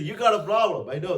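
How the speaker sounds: loud knowing reassurance; emphatic Jesus. Retold or imitated. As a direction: press it home, loud and high. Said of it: "He said".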